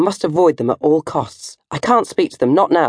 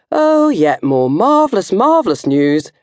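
sarcastic; 5 semitones higher than usual. Acted. Sarcastic diary complaint.

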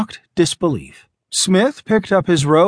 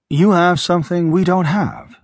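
usual speaking voice

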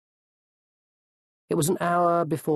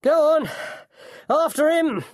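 high, loud cry to give chase; urgent shout; 4 dB louder than usual. A narrator's reading. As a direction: urge the pursuers on at full voice. Man urges on the chase.

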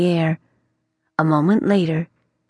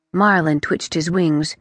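plain reading voice

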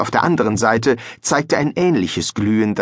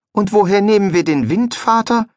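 put-on boyish voice, a bit louder and higher; young son, higher voice; 11 semitones higher, 4 dB louder than usual. Les asks his father where the wind should come from, higher and boyish. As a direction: ask it like a puzzled young lad.

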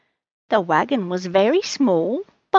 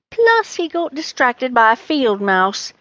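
ordinary delivery

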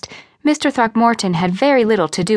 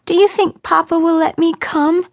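put-on high childish voice; small girl's high-pitched question; little girl, tears easing; 9 semitones higher than usual. A narrator's reading. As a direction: ask as a hopeful child after crying. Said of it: "her tears slowing a little".

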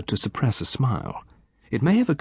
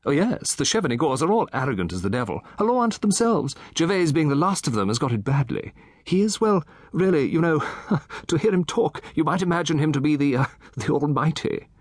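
lightly raised, eager gossip voice; a chatty old gentleman relishing his tale; Satterthwaite; 6 semitones higher than usual. Acted.

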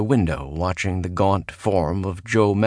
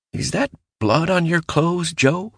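higher, gentler questioning voice; less accusatory; 11 semitones higher than usual. A narrator's reading. Bill asking Mallory about the blood, higher and careful. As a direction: ask it straight but without blame. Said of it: "his tone less accusatory than Galen's".

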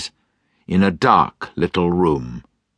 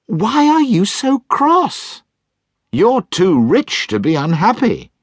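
smiling nephew; 4 dB louder than usual. Acted. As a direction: tease him gently, smiling.

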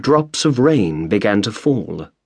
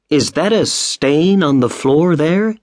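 higher, slow, worried question; concerned question; 6 semitones higher than usual. A narrator's reading. Mrs Otis asking the housekeeper about the stain, slow and worried. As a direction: point at the stain and ask slowly. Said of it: "she asked".

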